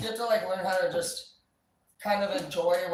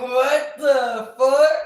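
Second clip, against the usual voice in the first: drawn-out, shocked what-the-fuck; slow and loud, stretched in disbelief; mock outraged cry at the kids; 7 semitones higher, 12 dB louder, much slower than usual. Mocking disrespectful teens.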